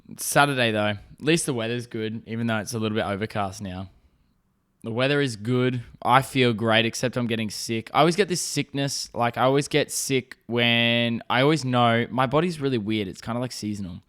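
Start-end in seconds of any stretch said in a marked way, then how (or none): none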